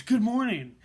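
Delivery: high, very loud imitation of a coworker; screamed greeting. Coworker repeating her greeting into silence, screaming. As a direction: holler it to force a reply. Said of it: "so she screams".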